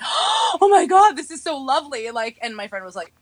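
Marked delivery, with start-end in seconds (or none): 0.0-2.1 delighted gasp. Retold or imitated. Telling a friend about a seat given up, gushing. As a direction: gasp like a charmed tourist. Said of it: "I'm like".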